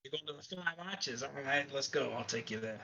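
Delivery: lightly voiced cab driver; casual gruff cabbie.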